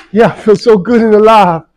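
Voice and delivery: loud, high, fired-up shout; pumped up. Real, not acted. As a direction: shout it out, full of energy. Pumped up at gym.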